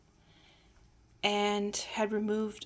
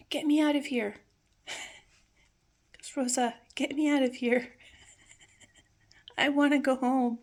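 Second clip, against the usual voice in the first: begging; 4 semitones higher, 4 dB louder than usual. Retold or imitated. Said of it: "And he just started begging".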